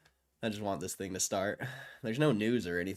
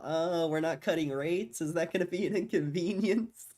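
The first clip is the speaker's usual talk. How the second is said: high, louder, put-on mocking voice; mock Jerome Powell; 8 semitones higher, 5 dB louder than usual. Streamer imagining Jerome Powell toying with viewers, mocking. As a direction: tease with mock concern, pitched well up.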